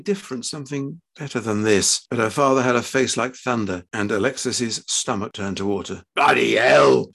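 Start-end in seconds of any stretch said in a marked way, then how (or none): 6.1-7.1 loud, furious exclamation; explosive outburst. A narrator's reading. Father exploding at sight of his daughter, furious outburst. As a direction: explode on both words. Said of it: "exploded Fred".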